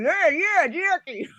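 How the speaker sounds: high, drawling caricature; Jackie Stallone. Acted. Showing how Jackie Stallone talks, high caricature voice. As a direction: stretch it out high in a put-on voice. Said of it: "she talks like".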